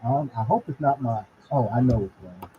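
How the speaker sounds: muffled, like through a wall. Real, not acted. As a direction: voice muffled and distant. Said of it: "You sound muffled".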